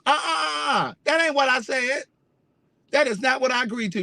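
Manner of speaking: high, sharp uh-uh of outrage; indignant protest. Real, not acted. To the cable company over the wrong time, a high outraged uh-uh. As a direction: refuse it, scandalised.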